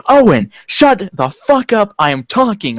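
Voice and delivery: slow, high, loud mock yell; hammed-up yell at a rival. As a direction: bark it out slowly at him. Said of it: "or some yelling shit like that".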